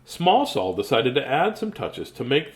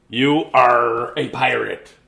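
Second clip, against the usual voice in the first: slow, loud pirate proclamation; 2 semitones lower, 5 dB louder, slower than usual. Acted. Handing Small Saul his diploma, slow loud pirate proclamation. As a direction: announce it like a pirate professor. Pirate college graduation line.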